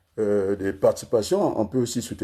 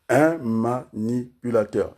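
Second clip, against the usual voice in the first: slow, chopped into syllables; 2 semitones higher, slower than usual. Shown as a demonstration. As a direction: dole the word out piece by piece. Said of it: "Là, je suis à quatre syllabes".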